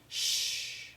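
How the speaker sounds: long hissed shh; sh sound. Shown as a demonstration. Teacher sounds out the final sh alone. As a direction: isolate the sound, just air. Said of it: "the sound at the end".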